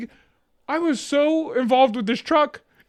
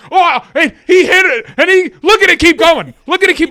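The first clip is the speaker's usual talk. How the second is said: mock angry; 7 semitones higher, 10 dB louder than usual. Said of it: "And she was mad".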